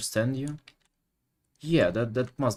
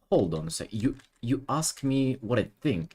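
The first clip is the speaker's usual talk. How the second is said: ordinary talk, nothing marked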